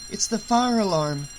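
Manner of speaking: put-on high-pitched boy's alarmed realisation; alarmed thought. A narrator's reading. Boy realising it's the fire alarm, alarmed. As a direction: raise the pitch as alarm dawns.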